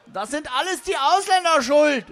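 high, loud ranting outburst; shouting Nazis. Acted. Two Nazis suddenly burst in blaming foreigners, shouting. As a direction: yell the accusation like a ranting bigot. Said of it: "riefen da plötzlich zwei Nazis".

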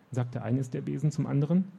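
ordinary delivery

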